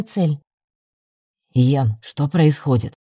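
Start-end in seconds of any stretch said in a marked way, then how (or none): 1.5-2.9 low, slow sullen question. A narrator's reading. Werewolf questioning the team leader on the course, sullen. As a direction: ask it gloomily in a deeper, drawn-out voice. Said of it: "хмуро спросил оборотень".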